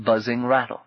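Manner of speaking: ordinary delivery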